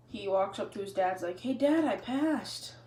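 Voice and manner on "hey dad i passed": high and slow, beaming announcement; proud. Acted. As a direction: stretch it out proudly to dad. Proud boy greets his dad.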